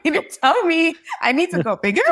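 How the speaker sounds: high, loud disbelief; exasperated at the CPA's advice; incredulous. Retold or imitated. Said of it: "Like, I'm crying".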